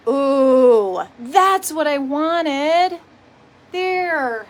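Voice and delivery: loud, drawn-out ooh of success; delighted exclaim. Real, not acted. Adjusting the camera for viewers, loud thrilled ooh. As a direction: cry out in pleased relief. Finally gets camera angle right.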